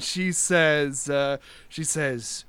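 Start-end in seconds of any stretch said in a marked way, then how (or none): none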